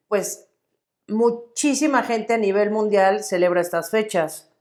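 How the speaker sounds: usual speaking voice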